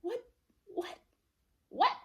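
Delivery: short questions with long gaps, acted out; stunned disbelief. Hearing her father was killed, retold stunned disbelief. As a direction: re-enact shock, each one a separate burst. Acts disbelief at news of death.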